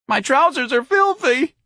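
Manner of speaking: very high, loud slow wail; shrieking. A narrator's reading. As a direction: shriek it in distress. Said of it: "the cowboy shrieked".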